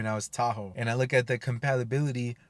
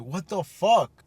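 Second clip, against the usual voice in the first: higher, louder and slowly drawn out; incredulous outburst; 7 semitones higher, 5 dB louder, much slower than usual. Retold or imitated. Told by the proctor to spit out his gum, disbelieving. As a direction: stretch it out in shocked disbelief.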